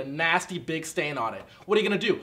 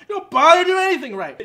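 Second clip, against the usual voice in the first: higher, louder bitter shout; angry outburst; 9 semitones higher, 8 dB louder than usual. Acted. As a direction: let the resentment blow up.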